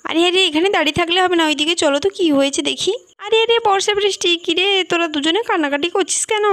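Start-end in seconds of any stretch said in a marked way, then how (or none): 1.2-6.5 put-on higher concerned voice asking the crying kids; an aunt hurrying over to see what's wrong; lightly voiced worried aunt. Adult woman asking children. (উদ্বিগ্ন কাকিমার গলা)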